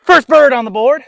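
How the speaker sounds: high, loud cheer of triumph; excited shout. Real, not acted. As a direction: yell it out after sinking it. Celebrating made birdie putt.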